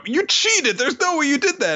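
high-pitched sore loser; outraged accusation. Acted. Retold loser accuses winner of cheating.

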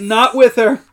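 high, loud mock protest; retold freak-out. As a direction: imitate a man freaking out. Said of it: "Clark freaks out".

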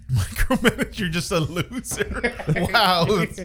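giggling while repeating the line; laughing mockery. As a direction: echo it back through laughter. Mocking friend's line, laughing.

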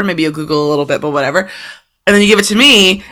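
ordinary talk, nothing marked

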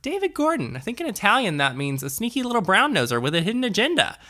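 high, stern mock teacher; stern Italian principal. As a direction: sneer like a strict principal. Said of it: "And she's like".

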